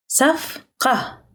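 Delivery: صفقة split into two separate syllables. Shown as a demonstration. Teacher breaking up a new word for learners, syllable by syllable.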